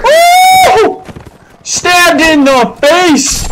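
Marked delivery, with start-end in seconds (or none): 0.0-1.0 excited woo. Real, not acted. Battle cry while gaming.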